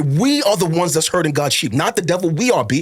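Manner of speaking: high-pitched, fervent pulpit delivery; impassioned preaching. Real, not acted. Pastor indicting himself and fellow pastors, impassioned preaching. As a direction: preach it with rising urgency and conviction.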